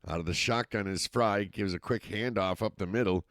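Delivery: steady live football call, rising with the action; play-by-play commentary. Acted. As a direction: call the game as it happens. Play-by-play resumes.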